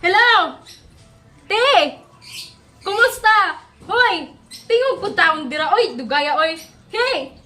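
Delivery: ordinary talk, nothing marked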